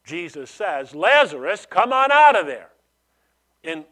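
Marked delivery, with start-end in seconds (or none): 1.0-2.7 loud, high shout summoning someone out; commanding call. Acted. Jesus calling dead Lazarus out of the tomb, commanding shout. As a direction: call out with full force, rising higher.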